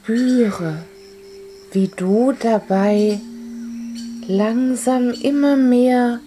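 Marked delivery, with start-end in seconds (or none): none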